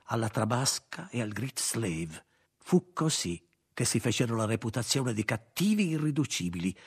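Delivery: ordinary delivery, nothing marked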